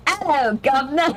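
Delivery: laughing into a bad Cockney accent; hammed-up Cockney greeting.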